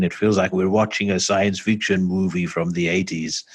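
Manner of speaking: put-on an amateur's stab at a German accent; a playful, hammed-up German accent; jokey German accent impression; mock German accent. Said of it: "my best, uh, attempt at a German accent".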